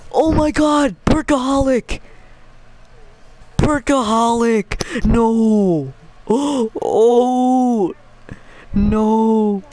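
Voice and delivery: excited gasping cries; shrieking jackpot winner. Real, not acted. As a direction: still shouting in disbelief. Still reacting to jackpot.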